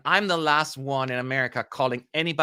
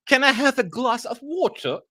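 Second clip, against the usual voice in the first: mock British accent, pitched higher; a posh British line, hammed up and high; poor attempt at a British accent; 10 semitones higher, 3 dB louder than usual. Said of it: "I cannot do a British accent".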